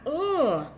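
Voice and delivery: high, loud waking-up exclamation; long, high pretend "oh"; waking bear's "oh". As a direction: act out a bear just waking. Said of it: "He's like".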